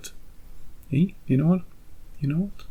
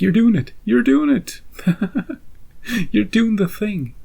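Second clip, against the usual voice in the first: delighted recognition; 8 semitones higher, 4 dB louder than usual. Retold or imitated. Watching himself do the Jeremy action, gleeful squeal. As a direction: pitch climbs higher and louder with glee, the last words slow. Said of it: "I'm like".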